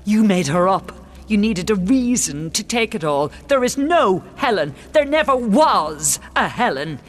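loud, high, emphatic shouting; furious denial. Acted. Queen insists the king invented Helen, furious denial. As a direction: pound out each denial at mounting pitch.

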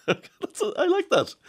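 real laugh breaking into the words. Real, not acted. Presenter laughing at the Julys text, voice jumping up.

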